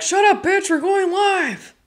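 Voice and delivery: high sharp voice, slowing on the end; mock scolding friend; friend snapping. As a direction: cut her off, high and slow. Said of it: "Lauren was like".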